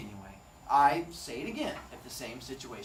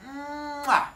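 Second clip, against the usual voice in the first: kiss sound; 11 semitones higher, 4 dB louder than usual. Acted. Wife's kiss sign-off.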